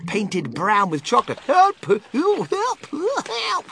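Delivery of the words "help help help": high-pitched drowning cries, fading in volume; boy's desperate high cries; yelling for help. A narrator's reading. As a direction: yelp for help, losing force each time. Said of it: "he yelled".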